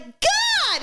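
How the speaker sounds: high mock exasperated yelp; Karen's exasperated cry. Karen flailing at the driver, high mock cry. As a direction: fling out a high frustrated cry. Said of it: "she's like".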